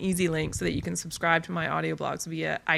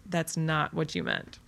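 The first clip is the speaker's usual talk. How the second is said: ordinary talk, nothing marked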